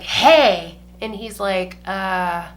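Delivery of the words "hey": put-on drunk woman's hey. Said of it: "turns around to him and is like".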